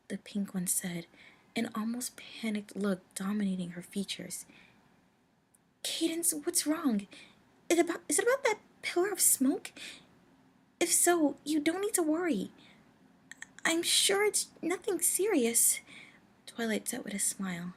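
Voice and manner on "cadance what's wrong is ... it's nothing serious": put-on young pony voice. Twilight voiced higher, worried.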